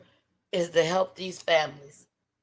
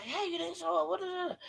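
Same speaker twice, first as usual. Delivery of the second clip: sweet call; 12 semitones higher, 11 dB quieter than usual. Retold or imitated. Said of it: "I'm like".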